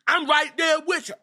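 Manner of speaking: high, loud, hammed-up shout. Jay shouting his backup to his partner in the ring, high and loud. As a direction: belt it out like a wrestler cutting in. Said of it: "Because Jay said".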